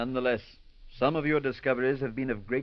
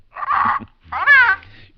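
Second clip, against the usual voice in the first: a long, piercing bird shriek, much higher and louder; parrot screech; 14 dB louder than usual. Acted. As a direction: let the parrot scream out and fall away.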